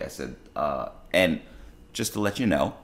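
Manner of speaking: ordinary delivery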